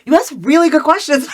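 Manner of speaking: bursts out laughing mid-sentence; high laughing shout, caught off guard. Real, not acted.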